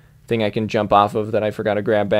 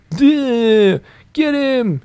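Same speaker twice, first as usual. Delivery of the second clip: much higher cartoon goon voice; dopey guard; 12 semitones higher, much slower than usual. Gamer imagines the guard ordering the chase, silly goon voice. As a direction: bark it like a dim henchman. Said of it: "it's like".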